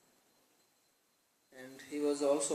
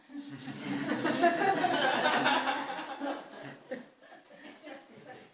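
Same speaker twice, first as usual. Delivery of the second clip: a long, high burst of real laughter; 12 semitones higher, 4 dB louder than usual. Real, not acted. Preacher delighted by the Lord's words, laughing aloud.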